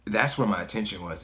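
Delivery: usual speaking voice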